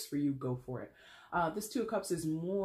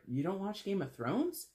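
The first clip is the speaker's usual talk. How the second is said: higher, rising disbelieving question; incredulous; 5 semitones higher than usual. Retold or imitated. Office coworker questioning a non-fan, incredulous. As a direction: imitate a coworker baffled by you. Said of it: "looking at 'em weird".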